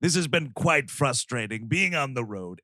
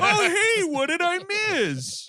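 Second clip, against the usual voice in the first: very high, hammed-up revived-from-the-dead voice; silly high-pitched put-on voice; Jim Belushi; 4 dB louder, slower than usual. Butterball stands back up as an ordinary person, goofy and oblivious. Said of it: "Jim Belushi".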